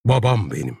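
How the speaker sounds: clear, measured declaration. A narrator's reading. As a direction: say it clearly and simply. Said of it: "net bir sesle konuştu". (net bir sesle)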